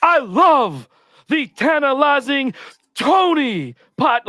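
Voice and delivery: very high, slow, stretched promo voice; wrestling promo. Shown as a demonstration. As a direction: ham it up like a heel promo. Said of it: "I'm gonna do another promo".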